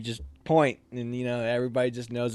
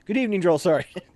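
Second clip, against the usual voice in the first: cheeky greeting; 7 semitones higher, 7 dB louder than usual. Retold or imitated. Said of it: "And I go".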